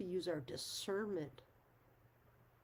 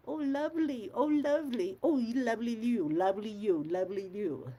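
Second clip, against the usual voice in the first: high, loud, sugary repeated "lovely", winding down; mock sing-song greeting; 4 semitones higher, 7 dB louder than usual. Community members greeting everyone without a name, a mock gushing sing-song. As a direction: gush it at everyone like a cloying acquaintance. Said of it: "they don't refer to us as, with a name".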